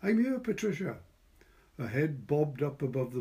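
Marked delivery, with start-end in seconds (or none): 0.0-1.0 high, loud call in reply; calling back. A narrator's reading. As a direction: call back over the wall. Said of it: "called back".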